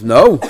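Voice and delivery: loud "No" swooping as a question. Acted. Owner answers about ripped clothes, questioning no. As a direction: throw out "No" as a challenge. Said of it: "it's in the question mark".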